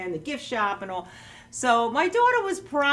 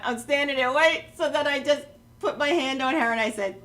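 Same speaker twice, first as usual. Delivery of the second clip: ordinary talk, nothing marked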